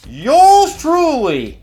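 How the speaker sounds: high, slow, drawn-out brag; mock grand self-praise; hammed-up boast. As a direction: savor it like a champion. Boasting own high score.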